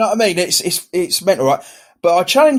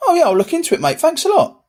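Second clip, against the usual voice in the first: much higher, polite colleague parody; mock polite brush-off; 12 semitones higher, faster than usual. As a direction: play the mate humoring him. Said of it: "he might go".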